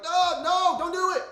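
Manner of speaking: high, loud panicked plea; yelling at the screen. Retold or imitated. Viewer yelling at a horror movie character, panicked plea. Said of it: "you're like".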